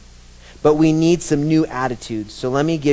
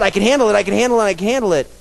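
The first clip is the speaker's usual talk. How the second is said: higher insistent repeat; mock self-assurance; 7 semitones higher, much faster than usual. Mocking repeated boast.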